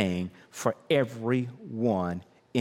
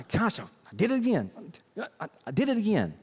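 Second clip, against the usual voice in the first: higher, a touch fast, mock frustrated self-talk; exasperated sinner; 7 semitones higher, faster than usual. Preacher voicing a churchgoer who slipped again, mock exasperated. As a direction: sigh it out like someone caught in the same sin.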